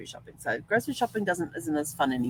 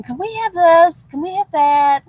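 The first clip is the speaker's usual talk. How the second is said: put-on much higher, louder pleading kid; whining kids; 12 semitones higher, 11 dB louder than usual. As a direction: nag like a begging child. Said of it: "instead of them".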